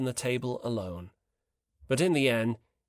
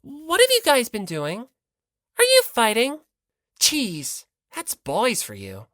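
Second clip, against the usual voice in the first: shocked; 8 semitones higher, 5 dB louder than usual. Acted. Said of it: "spouted out her usual phrases in shock".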